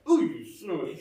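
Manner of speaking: mock indignation breaking into a laugh; laughing mock outrage. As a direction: sputter it through laughter. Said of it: "you're sort of like".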